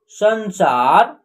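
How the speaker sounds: संसार drawn out very slowly, san-saar; slow blended word. Shown as a demonstration. Teacher slowly blends संसार for young readers. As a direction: stretch संसार slowly so every sound is clear. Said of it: "स के ऊपर अन की मात्रा सन".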